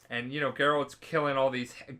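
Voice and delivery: usual speaking voice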